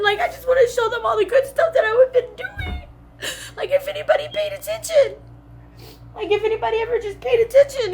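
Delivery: very high tearful voice; real crying. Real, not acted. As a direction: plead through sobs to be noticed. Crying, wanting efforts to be noticed.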